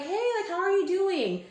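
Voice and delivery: high, upbeat retold hello; cheery greeting. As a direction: greet back brightly in a high voice. Man returns the happy greeting.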